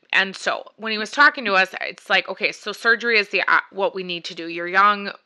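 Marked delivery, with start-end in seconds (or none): none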